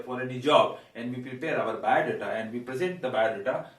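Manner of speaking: ordinary talk, nothing marked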